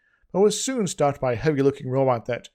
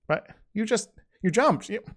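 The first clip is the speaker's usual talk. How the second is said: higher, halting disbelief; stammering; 7 semitones higher than usual. A narrator's reading. Avok questions Chris about his planned death, stammering high. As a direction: stumble over the words in shock. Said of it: "stammered Avok".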